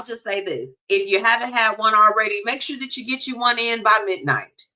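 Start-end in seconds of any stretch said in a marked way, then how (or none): none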